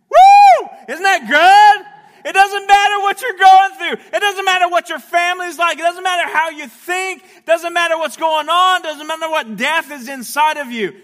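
high, shouted revival preaching; whoop, then shout it over the congregation; exultant full-force preaching. Real, not acted.

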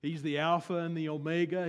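ordinary talk, nothing marked

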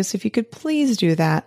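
ordinary talk, nothing marked

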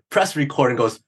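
ordinary delivery

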